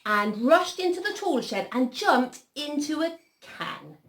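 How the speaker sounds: slow, high, dramatic storytelling; story narration. A narrator's reading. Reader telling of Peter jumping into hiding, dramatic storytelling. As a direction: draw out each step of the escape. Reads a picture story aloud.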